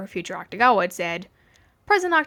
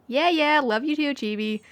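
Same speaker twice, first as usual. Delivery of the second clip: higher, breezy teasing; teasing chirp; 5 semitones higher than usual. A narrator's reading. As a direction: toss it off with a grin. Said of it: "the brunette chirped".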